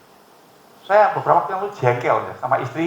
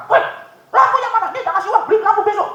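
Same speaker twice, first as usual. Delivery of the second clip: high, loud imagined scolding; mock angry telling off; 3 dB louder than usual. Preacher imagines scolding a motorbike rider without lights, mock angry. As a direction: snap at the rider, half joking. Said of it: "Itu emosinya begitu".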